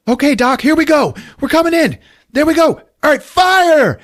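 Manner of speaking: high, loud, hammed-up battle cries; pilot calling out. Pilot to the doctor at the ray gun, excited mock shout. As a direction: shout orders to your gunner mid-attack.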